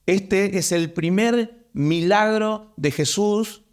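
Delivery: slow, deliberate, every word weighed; emphatic slow statement of the first miracle; slow emphasis. Shown as a demonstration. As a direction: lay it down slowly so they take note. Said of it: "Atención ahí".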